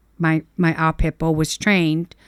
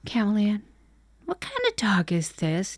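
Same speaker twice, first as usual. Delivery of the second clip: quiet; 7 dB quieter than usual. Retold or imitated. Mother asking daughter quietly.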